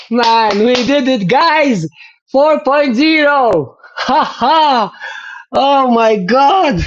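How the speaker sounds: loud, high, joyful shouting at the result; real excited cheer. Real, not acted. Rider shouting to viewers about his new record, excited cheer. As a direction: cheer it like you just won.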